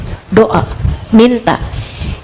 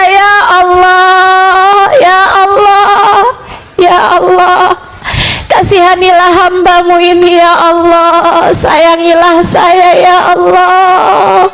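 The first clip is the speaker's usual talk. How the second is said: tearful prayer; 4 semitones higher than usual. Retold or imitated. Woman praying at night with tears, tearful plea. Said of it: "kamu berdoa dengan linangan air mata".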